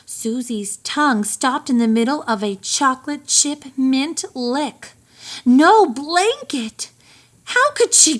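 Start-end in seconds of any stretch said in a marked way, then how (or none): 5.4-8.2 dismayed exclamation. Acted. Susie's shock at the news.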